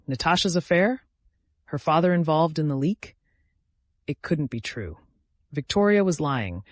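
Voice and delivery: plain reading voice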